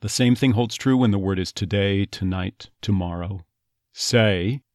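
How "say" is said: "Say" said "suh-ee" with a schwa start; Texas say. Shown as a demonstration. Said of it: "neutralization of the first half of that diphthong".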